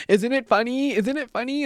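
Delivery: high, unhinged repeated question; mock manic. Streamer imitating self to worried coworkers, mock manic. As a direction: push it up like you are losing it, playfully.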